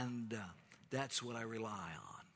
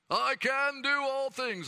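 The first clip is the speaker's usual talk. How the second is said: "do" in "I can do all things" shouted high and loud; mock superhero boast, much higher and louder; big boastful stress on DO; 13 semitones higher, 11 dB louder than usual. Shown as a demonstration. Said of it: "Sometimes we think that emphasis is on".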